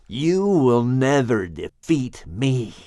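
slow, venomous snake hiss. Acted. Wounded snake hissing defiance at Shem, slow menacing hiss. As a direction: draw out a menacing serpent threat. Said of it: "Charlatan hissed".

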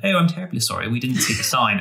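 mock upper-class English apology; lightly hammed-up posh English accent; fake posh English accent. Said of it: "a fake posh accent".

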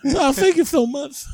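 high-pitched lisping boxer voice; reply in a high, lisped celebrity impression; Mike Tyson. Comedian answering the comparison, playing up the lisping boxer. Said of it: "like, Mike Tyson".

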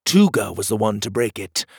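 ordinary delivery

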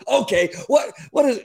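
high, shouted exasperated what; angry judge. Retold or imitated. Judge blowing up at the lawyers, shouting. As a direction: judge losing it. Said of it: "he got so angry".

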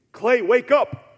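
very high, very loud shout; yelled wake-up call. Acted. God imagined waking Clay with an obvious call, yelled. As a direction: yell to jolt a sleeper awake. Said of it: "because enemies yell".

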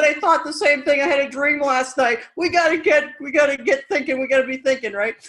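eager retold rallying call; fired-up pep talk. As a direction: rouse a frightened brother into action. Retelling her pep talk to brother.

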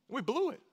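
We BLEW it, thrown up high; high emphatic cry. Acted. Preacher crying out that we failed, high and rising.

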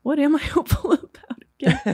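high-pitched, cracking up mid-question; laughing self-mockery. Real, not acted. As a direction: ask it while laughing at yourself. Laughs at own lack of hope.

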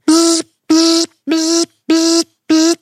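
high, loud buzzing bursts; short even mechanical buzzes, high-pitched; imitated printer buzz. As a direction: buzz like a printer going back and forth.

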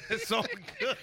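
squeaky laughing repeat; giggling it's all good. Real, not acted. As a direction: say it through a big laugh. Laughing repeat of own line.

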